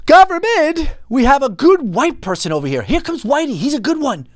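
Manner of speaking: high-pitched, sarcastic cry of horror; mock alarm. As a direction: sound the alarm in a mocking high voice.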